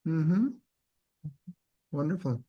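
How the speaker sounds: normal talking voice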